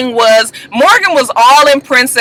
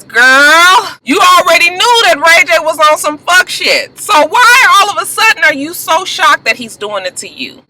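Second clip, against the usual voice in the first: high-pitched, exasperated telling-off; exasperated scolding; 7 semitones higher than usual. Pretend. Host telling Morgan off for being shocked, exasperated. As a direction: scold her like a fed-up friend, pitched up.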